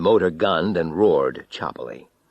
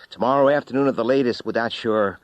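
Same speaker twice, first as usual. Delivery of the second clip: brisk answering service; 5 semitones higher, 5 dB louder, faster than usual. Acted. Answering service replies on phone.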